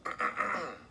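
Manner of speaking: gruff little throat clear. A narrator's reading. Hotel host readying to address guests, throat clearing. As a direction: clear the throat before an announcement. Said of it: "cleared his throat".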